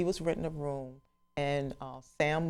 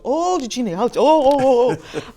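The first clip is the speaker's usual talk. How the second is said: high, louder and louder oh's; dawning excitement; 10 semitones higher, 12 dB louder than usual. Retold or imitated. Harold realising who's calling, excited. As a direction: recognition turning to thrill.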